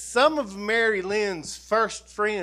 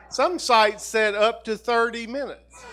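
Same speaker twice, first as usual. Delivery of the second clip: laughing; 5 semitones higher, 3 dB louder than usual. Real, not acted. Joking about speech length.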